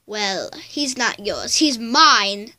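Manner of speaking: ordinary talk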